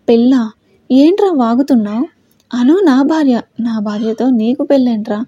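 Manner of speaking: ordinary delivery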